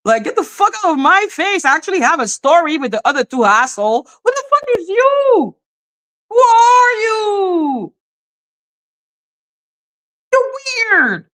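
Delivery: high-pitched indignant outcry; mad and annoyed. Retold or imitated. Telling off the woman who struck the streamer, high exasperated retelling. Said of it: "mad at her and annoyed".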